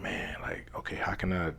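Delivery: soft unvoiced whisper to himself; whispered prayer. Man whispering to himself during meditation.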